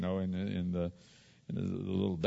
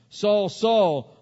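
high, loud call of the name; God calling out; 11 semitones higher, 11 dB louder than usual. Retold or imitated. God calling Saul on the Damascus road, loud and high. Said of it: "God said to Saul".

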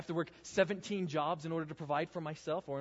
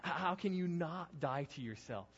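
ordinary talk

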